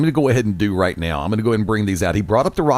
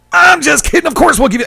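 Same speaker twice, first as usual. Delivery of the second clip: much higher and louder, a full wrestling promo roar; booming promo yell; 13 semitones higher, 7 dB louder than usual. Retold or imitated. Retelling Wick's promo to the Express, high booming yell. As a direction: blast it like a heel on the mic. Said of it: "Wick has the microphone".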